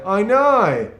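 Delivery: I know with a high, loud, swooping Aussie no; Australian accent. Shown as a demonstration. Visitor imitates how Australians say I know, exaggerated. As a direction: stretch the no up and down, broad Aussie. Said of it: "Like, they say".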